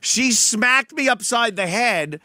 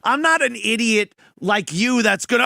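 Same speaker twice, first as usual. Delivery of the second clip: ordinary talk, nothing marked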